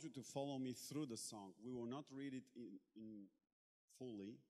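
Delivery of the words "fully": normal talking voice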